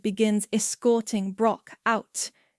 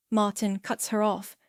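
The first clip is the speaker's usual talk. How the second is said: ordinary delivery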